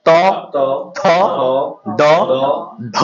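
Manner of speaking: ত থ দ ধ spaced, tongue against the teeth; dental letter demo. Shown as a demonstration. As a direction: sound ত থ দ ধ one by one. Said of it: "এবার দেখেন দন্ত".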